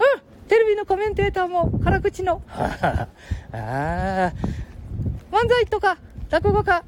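put-on high squeaky boy voice; Ken-chan voice.

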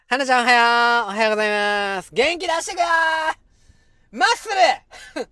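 high, loud rallying morning call; hearty cheer. Pretend. Calls out hearty morning greeting. (元気よく声を張った掛け声)

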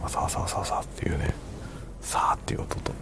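soft ASMR whisper. Shown as a demonstration. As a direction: breathe the words like an ASMR clip. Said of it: "よりエーエスエムアールっぽいんじゃないですか".

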